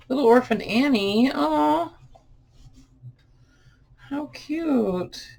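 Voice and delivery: high, delighted coo over a find. Real, not acted. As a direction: gush over something cute. Delighted at finding old book.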